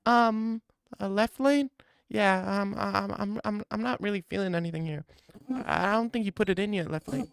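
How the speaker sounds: put-on high, soft unimpressed girl voice; deadpan and high, not feeling it; bored girl. Girl unimpressed by Left Lane, high and soft.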